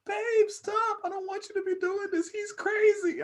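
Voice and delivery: high falsetto, whiny and pleading; mocking crying girlfriend. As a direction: play the girlfriend begging him to stop in a squeaky high voice. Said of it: "you hear her in the lobby just crying".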